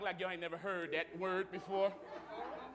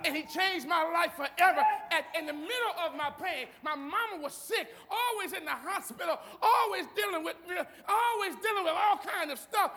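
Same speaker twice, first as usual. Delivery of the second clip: higher, emphatic testimony from the pulpit; impassioned preaching; 7 semitones higher than usual. Real, not acted. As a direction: preach your testimony with rising fervour.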